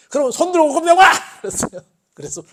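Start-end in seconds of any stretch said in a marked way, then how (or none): 0.1-1.1 robber's shout. Acted. Robber barks at teacher.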